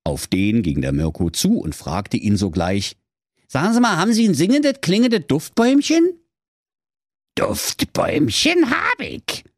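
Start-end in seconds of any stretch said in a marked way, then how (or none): 3.5-6.2 high, quick, eager hero voice; eager young hero. Acted. Marco asks the dwarf for an air freshener, high and eager. 7.3-9.4 high, slow, grumbling dwarf voice; grumpy dwarf. Acted. The dwarf answers Marco grumpily in a high voice. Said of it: "sagte der Zwerg Grützelbart mürrisch".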